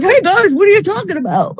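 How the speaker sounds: high, goofy grinning voice; goofy greeting. Retold or imitated. Friend barging into a private talk, high goofy voice. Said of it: "big goofy grin on his face".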